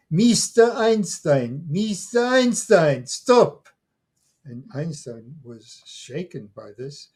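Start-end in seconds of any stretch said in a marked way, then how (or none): 0.1-3.7 put-on high, loud calling out of an old woman; retold yell. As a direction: call out to him across the park, urgent. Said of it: "yelled out to him".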